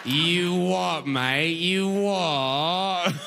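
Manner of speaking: hammed-up cockney with long drawn-out vowels; exaggerated cockney catchphrases, stretched out; mock British accent. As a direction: parrot British sounds with silly relish. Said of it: "And you'll be like".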